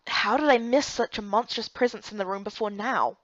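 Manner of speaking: ordinary talk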